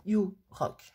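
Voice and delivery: French-accented English; careful, playful go at English pronunciation; self-mocking English accent. Real, not acted. Crafter showing off her "super accent", in French-accented English. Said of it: "Avec mon super accent".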